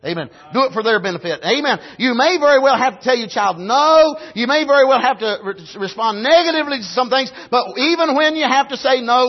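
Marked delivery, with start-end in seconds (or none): none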